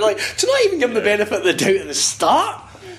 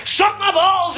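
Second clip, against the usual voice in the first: mock yell; 10 semitones higher, much slower than usual. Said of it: "I'll run up".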